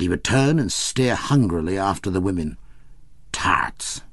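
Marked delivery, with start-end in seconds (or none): 3.3-4.0 hungry whispered murmur. A narrator's reading. Paddy stares after passing women, murmuring hungrily. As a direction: breathe the word like a boy at a sweet shop. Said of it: "he would murmur".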